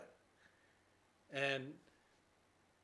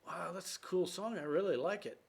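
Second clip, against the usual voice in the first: put-on higher, eager teenage son voice; excited teen son; 6 semitones higher than usual. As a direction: play the thrilled kid asking about the song.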